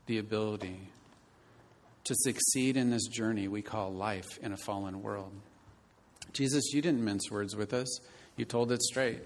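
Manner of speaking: earnest pastor's prayer to the Father; closing prayer. Acted. Pastor closing the sermon before his church, earnest prayer. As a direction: lead the congregation in prayer, sincere and steady.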